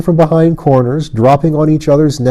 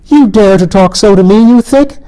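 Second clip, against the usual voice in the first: much higher, louder indignant cry; outraged queen; 9 semitones higher, 5 dB louder than usual. A narrator's reading. Fairy queen outraged that Colin defies her, loud and high. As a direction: shriek your outrage at the boy.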